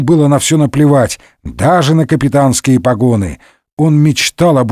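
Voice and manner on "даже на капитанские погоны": ordinary delivery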